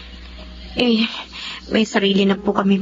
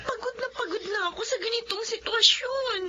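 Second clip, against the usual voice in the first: high, breaking cry, half shouting; sobbing out her exhaustion, voice cracking; crying out in despair; 11 semitones higher, 11 dB quieter than usual. Acted. Wife crying to her father-in-law, worn out and breaking.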